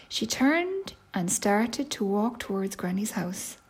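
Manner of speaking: ordinary delivery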